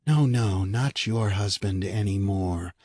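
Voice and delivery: plain reading voice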